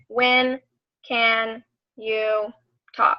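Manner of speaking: spaced out word by word; word-for-word dictation. Shown as a demonstration. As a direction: dictate it slowly with a pause between words. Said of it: "like, word for word".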